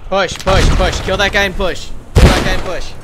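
shouting over gunfire. Real, not acted. Leader orders squad to push.

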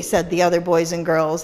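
plain reading voice

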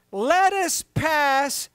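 deliberate slow proclamation; slow emphatic quote. Acted. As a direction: draw each word out slowly. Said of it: "This is my revelation".

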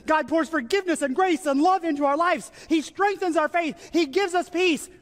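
high, sustained sermon fervor; impassioned preaching. Real, not acted. Preacher proclaims the mystery of the Lord's Supper, impassioned preaching. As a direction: proclaim it with soaring pitch and conviction.

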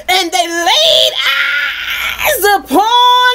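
drawn-out, rising preacher's misquote; mock scripture reading. Preacher voices what the verse didn't say, mock reading. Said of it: "It didn't say".

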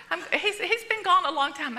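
ordinary talk

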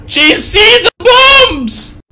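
very high, loud exclamation; startled high-pitched shout; alarmed yell. Real, not acted. Startled by bombs in game.

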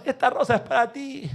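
high-pitched imitation of a wailing son; mock a mourner's cry, high without much volume; retold grieving cry. Son offering roses at his mother's coffin, high imitated cry. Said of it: "venía gritando desde fuera del cementerio".

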